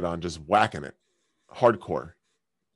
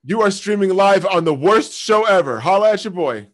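higher and louder showman announcing; mock hype; 8 semitones higher, 8 dB louder than usual. Announcing to strangers on the worst show ever, showman hype. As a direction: pump it up like a cheesy host.

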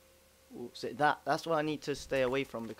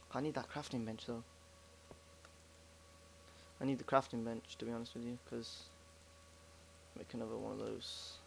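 talking low; 9 dB quieter than usual. Real, not acted. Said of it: "Sorry if I was talking a bit low then".

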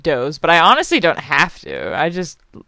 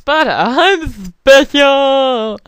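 higher, slow squeak of a proud little character; squeaky character voice; 8 semitones higher, 7 dB louder, much slower than usual. Pretend. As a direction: stretch it out in a tiny boastful voice.

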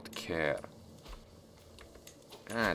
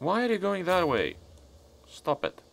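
exasperated; 11 semitones higher than usual. Real, not acted. Units move the wrong way.

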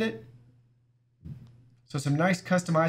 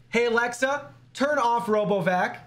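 voice command; 7 semitones higher than usual. Real, not acted. Giving a voice command to Alexa.

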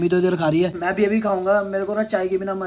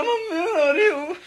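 put-on cry-talk like a kid faking tears; mock crying; 11 semitones higher than usual. Shown as a demonstration. Showing mom how to say it with fake crying. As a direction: fake whimper through laughter, high. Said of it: "ऐसे बोलो".

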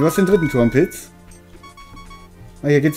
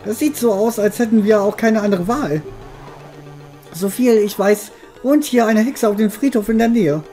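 lightly higher character voice; game character voice; 7 semitones higher than usual. Acted. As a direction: read the character's lines. Reads a game character's lines.

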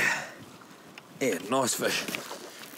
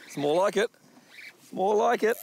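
usual speaking voice

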